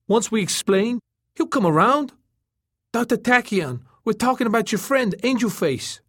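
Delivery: very high, insistent turtle voice. A narrator's reading. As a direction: press the case in a high pitch. Turtle's appeal in dialogue.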